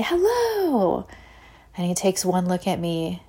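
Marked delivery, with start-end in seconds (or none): 0.0-1.1 very high, drawn-out greeting falling at the end; exaggerated polite high hello; friendly sing-song hello. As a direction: greet the shopkeeper in a high chirpy singsong. Her own friendly greeting, acted out.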